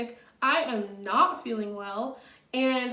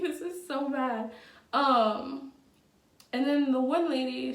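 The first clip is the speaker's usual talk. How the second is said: ordinary delivery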